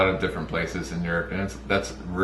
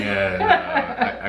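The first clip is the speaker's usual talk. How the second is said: laughing; 13 semitones higher, much slower than usual. Real, not acted. Laughing at his Jersey joke.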